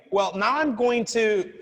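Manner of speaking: usual speaking voice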